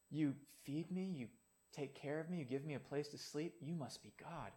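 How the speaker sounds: soft, grateful voice of a faithful dog; humble dog. Retold or imitated. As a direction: look up at your owner in simple gratitude. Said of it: "the dog says".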